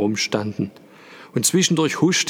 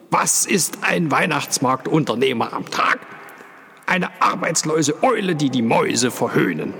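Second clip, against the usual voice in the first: 1 indignant Paul; 5 semitones higher than usual. A narrator's reading. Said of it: "flammte unmutig auf".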